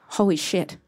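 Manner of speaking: ordinary delivery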